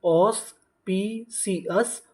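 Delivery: Auspicious stretched out very slowly with a rising end; slow drawn-out model word. Shown as a demonstration. Teacher to students, slowly modelling auspicious with a rise. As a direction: draw out auspicious syllable by syllable, rising. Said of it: "तो पूरी meaning क्या बनी?".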